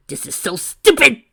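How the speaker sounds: high, loud frustrated cry; frustrated outburst. Acted. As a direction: blow up in frustration. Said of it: "Tracy cried in frustration".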